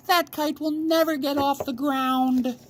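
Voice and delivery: very high, squeaky mocking birds; high chirpy bird voices scoffing; jeering robins. A narrator's reading. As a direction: taunt like cheeky little birds.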